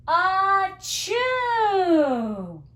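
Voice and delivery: loud, high hammed-up sneeze; big sneeze. Teacher acting out the bear's loud sneeze for the children. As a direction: blast out a storybook sneeze. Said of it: "He blows and he sneezes a chew".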